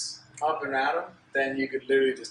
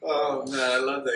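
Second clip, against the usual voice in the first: ordinary talk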